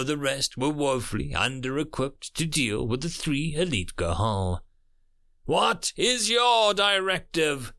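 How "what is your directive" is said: slow, high, raised demand; drawn-out, insistent alien question; calling over sirens. A narrator's reading. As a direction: project it slowly over loud noise. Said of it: "his voice all but drowned out".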